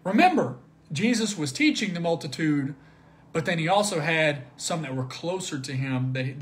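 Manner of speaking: ordinary delivery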